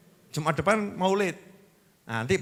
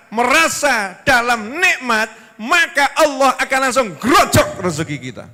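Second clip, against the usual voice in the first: raised, high, insistent preacher's delivery; emphatic preaching; 6 semitones higher, 5 dB louder than usual. Real, not acted. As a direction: drive the lesson home to the congregation, high and forceful.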